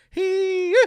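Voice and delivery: high, loud folksy hee-yee whoop; excited whoop. Acted. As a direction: whoop like a fiddler taking the stage. Double act bounds on stage.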